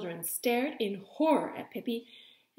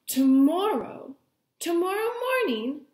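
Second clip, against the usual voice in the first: excited; 7 semitones higher, 7 dB louder than usual. Acted. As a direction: proclaim it with a sudden bright idea. Pippi excited about school plan.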